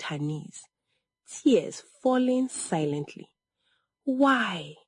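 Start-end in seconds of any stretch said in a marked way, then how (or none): none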